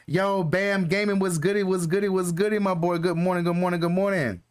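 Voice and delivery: stretched-out excited hello to a chatter; hyped greeting. Real, not acted. Greeting a viewer in chat.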